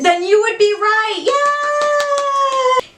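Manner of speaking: high, drawn-out squeal of a yay; excited cheer. Real, not acted. Vlogger reveals the Exorcist shirt to viewers, high excited cheer. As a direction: celebrate the reveal in a high pitch.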